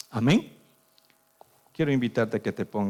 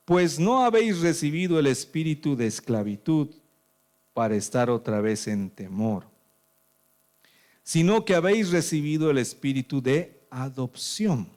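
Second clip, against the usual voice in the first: raised, declaiming Bible reading; scripture reading; 5 semitones higher, 4 dB louder than usual. Acted. Pastor reading the verse aloud, declaiming. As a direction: proclaim the verse to the church.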